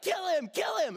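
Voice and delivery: high-pitched chanting outcry; mock crowd outrage. Moviegoers calling for the traitor's death, high mock outcry. Imitating the angry cinema crowd.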